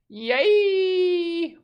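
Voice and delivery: very high, loud drawn-out squeal; playful falsetto cheer. Pretend. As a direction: let it ring out high and long. Cheering for a chat greeting.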